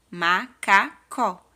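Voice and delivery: Macacó drawn out slowly; teacher blending Macacó slowly; slow whole word. Shown as a demonstration. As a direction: stretch macaco slowly, end stressed. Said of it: "vou preencher onde tem três quadrinhos".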